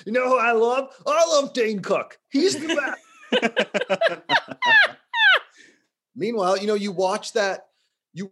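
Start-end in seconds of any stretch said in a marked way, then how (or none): 0.1-3.0 gushing fan impression. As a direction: ham up a starstruck fan. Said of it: "They're like".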